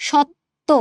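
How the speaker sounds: সত্য split as "shot-to"; sounded out. Shown as a demonstration. Teacher showing which sounds are heard, sounded out. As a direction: break সত্য between the two t sounds. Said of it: "স আর ত উচ্চারণ হচ্ছে".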